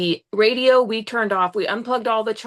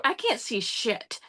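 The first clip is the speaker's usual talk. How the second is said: ordinary talk, nothing marked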